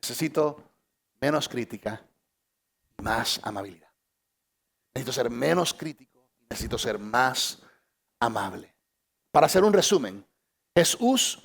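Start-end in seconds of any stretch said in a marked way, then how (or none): 0.0-8.7 quiet confession. Retold or imitated. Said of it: "más aquí que dicen".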